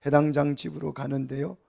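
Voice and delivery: usual speaking voice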